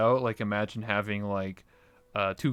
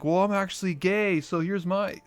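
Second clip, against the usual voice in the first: much higher hammed-up announcement; twist reveal; 8 semitones higher than usual. Guest on a talk show revealing a twist, high mock voice. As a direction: camp mock confession. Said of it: "he's like".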